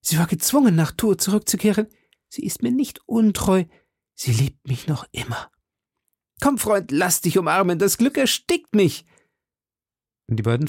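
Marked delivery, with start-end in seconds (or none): 0.0-9.0 high-pitched, breathless-with-happiness outburst; almost delirious joy. A narrator's reading. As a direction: burst with joy to a dear friend. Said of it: "rief Aramis fast wahnwitzig". (außer sich vor Glück)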